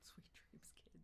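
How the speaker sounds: soft unvoiced goodnight whisper. Ending the stream, whispering goodnight to viewers. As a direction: whisper goodnight like tucking kids in. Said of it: "signing off stream".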